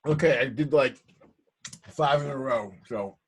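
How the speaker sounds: talking through a mouthful; words round a bite of food; mouth full. Real, not acted. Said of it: "that's me trying to talk my mouth full".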